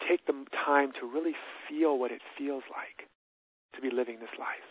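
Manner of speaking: calm, unhurried, with long pauses for breath; guided meditation. Shown as a demonstration. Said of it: "I'll take 'em through a short version".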